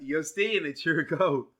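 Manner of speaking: ordinary talk, nothing marked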